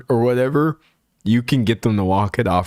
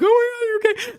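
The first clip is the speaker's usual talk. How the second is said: much higher, laughing fake panic; mock panicked parent; faster than usual. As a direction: ham up a parent freaking out over a fall. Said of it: "you start freaking out".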